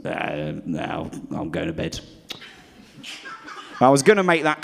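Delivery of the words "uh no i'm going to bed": mumbled low and soft, done with it; quiet mumble. Retold or imitated. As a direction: let it drop to a sleepy mumble. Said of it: "And then he goes".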